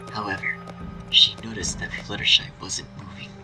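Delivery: plain reading voice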